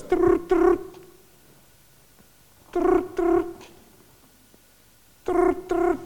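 high trilled ring with pauses; trill, pause, trill again; phone ring. Shown as a demonstration. Showing how a real phone rings, trills with pauses. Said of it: "Because the phone goes".

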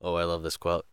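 ordinary talk

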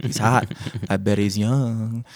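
a hint of a devil whispering temptation; Greek devil on shoulder. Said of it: "the Greek devil on my shoulder".

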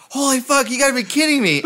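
high-pitched, exasperated outburst; you cannot believe your bad luck; exasperated disbelief. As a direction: act out desperate disbelief. Acts disbelief at the sign.

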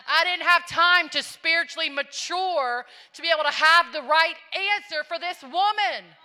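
high, loud, impassioned sermon delivery; full-force preaching. Real, not acted. Preacher urging the church to witness by love, full-force preaching.

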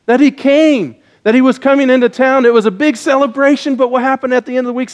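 lively preaching. Acted. Pastor preaching about Easter to children, energetic. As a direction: preach it up for the kids.

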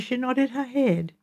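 plain reading voice

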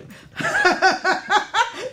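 high rising laugh. Real, not acted. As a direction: laugh at your husband. Laughs at husband with book.

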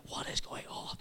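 voiceless, amazed hush; awed whisper. Retold or imitated. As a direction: whisper it in disbelief. Amazed reaction in the meeting.

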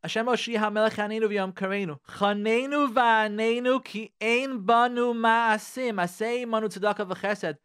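rapid rhythmic Hebrew recitation; fast prayer davening. Acted. Rabbi reading Tachanun to listeners, fast davening chant. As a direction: rattle off the prayer like at morning services.

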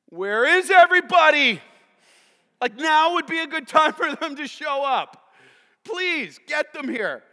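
high, loud pleading outburst; exasperated servant. Acted. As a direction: demand the missing help as a desperate servant. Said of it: "if I'm the servant, I'm like".

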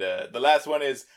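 ordinary talk, nothing marked

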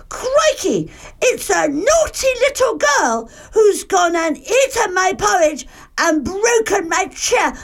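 very high, excited cub voice; high-pitched little bear tattling; Baby Bear crying out. A narrator's reading. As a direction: squeal the discovery to mummy. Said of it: "and cried out".

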